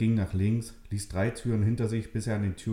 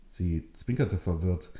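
usual speaking voice

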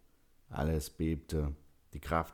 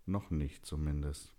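plain reading voice

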